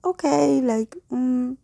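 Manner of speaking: lightly imitated sneaky agreement. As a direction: play at being slyly noncommittal. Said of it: "in a sneaky way".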